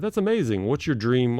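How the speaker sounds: normal talking voice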